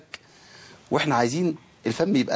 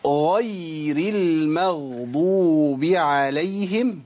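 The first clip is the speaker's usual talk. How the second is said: غير المغضوب عليهم stretched out, each sound clear; very slow recitation; 2 semitones higher, much slower than usual. Shown as a demonstration. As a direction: recite it extremely slowly. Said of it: "شوف أنا قلتها بالبطيء إزاي".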